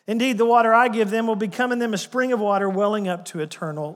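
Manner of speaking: preaching at full force. Acted. Preacher booming Jesus' promise to the Samaritan woman, preaching loud. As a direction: declare it like a revival preacher.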